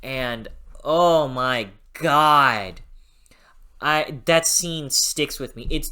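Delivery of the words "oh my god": usual speaking voice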